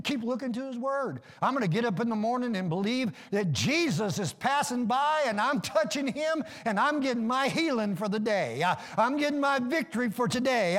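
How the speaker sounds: high, driving preacher's build; full-force preaching. Real, not acted. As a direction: preach it with rising, insistent conviction.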